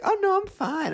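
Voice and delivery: very high, lighter put-on voice; imitated dismissive friend. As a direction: brush it off in a squeaky voice. Retells friend brushing it off.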